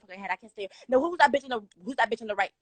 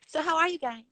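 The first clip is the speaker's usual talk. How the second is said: ordinary talk, nothing marked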